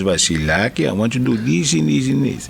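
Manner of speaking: Greek yaya giving orders; Greek-accented grandmotherly nagging. Retold or imitated. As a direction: instruct like a Greek granny. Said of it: "this is the way she would talk".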